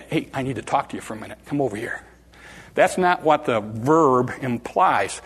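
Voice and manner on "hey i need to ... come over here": low, quick and offhand; casual aside. Retold or imitated. Mistaken idea of Peter taking Jesus aside, casual and low. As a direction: draw someone aside softly and casually. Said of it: "a lot of people think".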